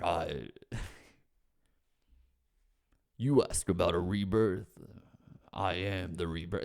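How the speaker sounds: low, hushed mock-spooky villain; a hint of a brooding wrestling villain.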